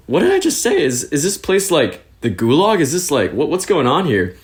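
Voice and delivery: high-pitched, thrown-off questioning; retold bewilderment. Newcomer questioning driver about Vidin, retold bewilderment. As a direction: stumble through mock alarmed questions.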